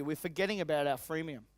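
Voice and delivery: ordinary talk, nothing marked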